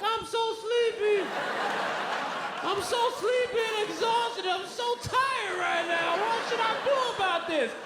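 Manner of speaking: put-on high, slow, whining baby voice; hammed-up exhausted whimper, drawn out; whiny sleepy baby. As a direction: whine like an overtired baby. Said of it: "I don't stay woke like".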